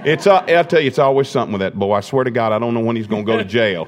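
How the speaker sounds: exasperated Southern father riffing; Southern accent. Acted. Father complaining that his boy is always trouble, Southern accent. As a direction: grumble it in a put-on Southern accent. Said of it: "he had a Southern accent at that moment".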